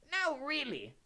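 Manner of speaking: high, fretful professor voice; anxious. A narrator's reading. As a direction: protest anxiously at the unruly class. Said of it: "said Professor Tofty anxiously".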